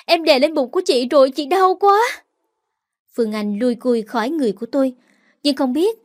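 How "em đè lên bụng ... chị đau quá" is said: high, loud pained plea; pained complaint. Acted. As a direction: cry out from the belly pain. Pressed on belly, in pain.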